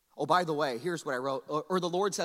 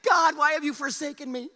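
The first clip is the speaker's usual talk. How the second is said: loud, high melodramatic cry; mock anguish; 8 semitones higher, 8 dB louder than usual. Diner cries to God over an hour wait, mock anguish. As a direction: lament it as if utterly abandoned. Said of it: "trying to get a reservation".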